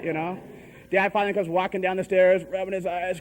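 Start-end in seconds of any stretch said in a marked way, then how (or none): none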